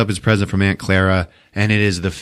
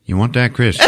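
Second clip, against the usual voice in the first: normal talking voice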